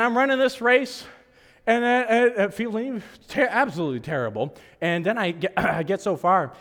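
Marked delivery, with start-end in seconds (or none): none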